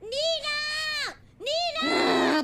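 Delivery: high shouted call. Real, not acted. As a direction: call her from another room. Calling out across the house. (appel crié)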